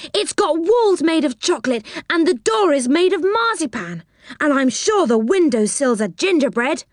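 put-on high, wondering child's voice; excited cry. A narrator's reading. Rick describing a shop of sweets, high and excited. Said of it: "cried Rick".

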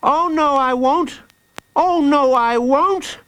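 put-on high-pitched child's refusal; defiant child. Jacob refuses to scream for Hooded Fang, defiant.

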